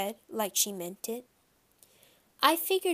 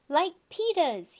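put-on higher, louder, slow little-kid voice; toddler demand; 8 semitones higher, 5 dB louder than usual. A narrator's reading. Toddler telling his mother which shoes he wants, high and loud.

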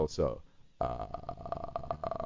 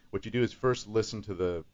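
ordinary talk, nothing marked

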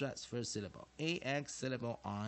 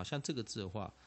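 ordinary delivery

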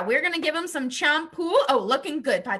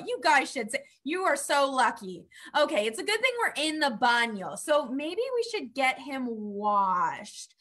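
ordinary delivery

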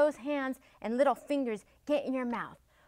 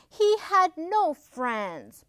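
ordinary delivery

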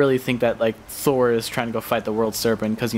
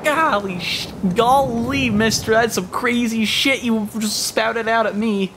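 golly-gee kid, voiced lightly higher; wholesome 1950s kid; 9 semitones higher, slower than usual. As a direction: play an old-timey innocent kid. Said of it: "Gee willikers, Batman".

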